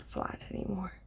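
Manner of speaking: soft whisper. Acted. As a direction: breathe the words out. Whispers the result.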